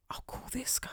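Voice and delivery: mock scheming whisper; whispered inner thought. Voicing the recruiter's thought, a sneaky whisper. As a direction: whisper it like a sly plan.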